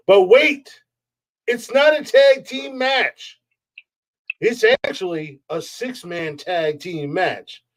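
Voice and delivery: high, slow ring announcer hype; wrestling announcer. Acted. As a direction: drag out the champ's intro.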